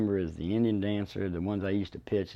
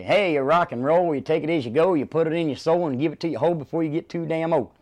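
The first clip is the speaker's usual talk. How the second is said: rhyming barker call; 7 semitones higher, 8 dB louder than usual. Retold or imitated. Barker hollering rhymes at a couple on the midway. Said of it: "I might say".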